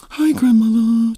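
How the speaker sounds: high, drawn-out sugary hello; mock relatives cooing at grandma; fake-sweet greeting. Mimics relatives being fake nice.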